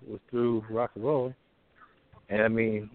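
usual speaking voice

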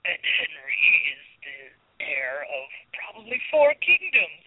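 slow, silky storybook narrator voice. Retold or imitated. Quoting the show's bonus feature to co-hosts, silky narrator voice. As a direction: imitate a silky narrator, drawing the words out. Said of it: "in the, very silky narrator voice".

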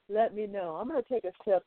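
ordinary delivery

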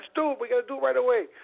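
high-pitched, eager reply; excited agreement. Retold or imitated. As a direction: jump in, raring to go. Retold eager answer to kid.

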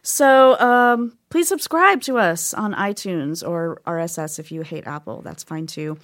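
normal talking voice

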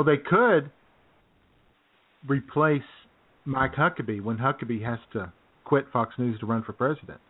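normal talking voice

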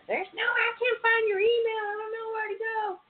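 squeaky helpless caller imitation; confused caller. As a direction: play a lost customer who can't find the email. Callers who can't find email.

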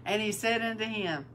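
usual speaking voice

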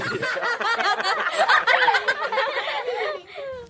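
high giggling laugh. Acted. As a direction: burst of giggling. Laughter on news segment.

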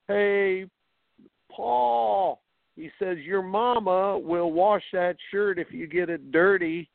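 put-on high, teasing kid's taunt from the dugout; mocking dugout kid. Teammate teasing the shortstop from the dugout, high mocking call. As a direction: rib him like a cheeky teammate. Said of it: "this kid goes".

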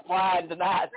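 ordinary talk, nothing marked

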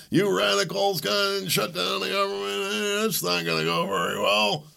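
high, slow mocking Obama voice. Mocking Obama scolding radicals, high slow put-on voice. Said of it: "That's why Obama's laughing".

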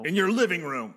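loud, high wrestling promo. Acted. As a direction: hype it up like a ring promo. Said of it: "promo, uh, cut one".

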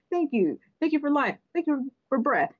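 mock-manic thank yous, pitched high and fast; manic gratitude. Mocking people who gush thanks all day, lightly manic. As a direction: gush thanks like a giddy zealot. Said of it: "you sound like a maniac".

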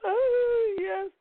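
high, teary cry of relief; tearful yes. Retold or imitated. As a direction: break down and gratefully accept. Crying girl accepts the hug.

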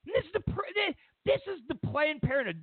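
outraged high emphasis; incredulous. Real, not acted. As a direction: pitch it way up in disbelief.

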